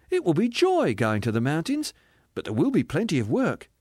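joyful spirit. Acted. Spirit promising work in the mountains, joyful. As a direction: promise it happily.

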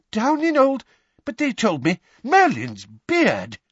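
high, loud shocked exclamation; alarmed. Acted. Father realising they are late, alarmed. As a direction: break off aghast and cry out.

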